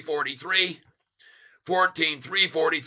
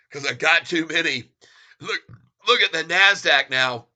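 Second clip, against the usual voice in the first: normal talking voice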